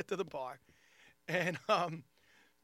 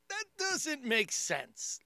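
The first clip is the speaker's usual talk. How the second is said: very slow, higher disbelief; skeptical; 7 semitones higher, much slower than usual. Retold or imitated. Comic doubting friend's YouTube fame, slow skeptical disbelief. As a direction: drag it out in doubt. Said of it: "And I'm like".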